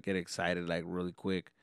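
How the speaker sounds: normal talking voice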